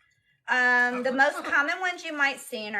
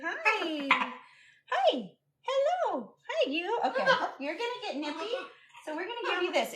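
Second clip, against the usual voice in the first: high, soft cooing; 8 semitones higher, 4 dB quieter than usual. Real, not acted. Owner distracting a nippy bird, high cooing. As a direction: coax a pet in sweet high tones.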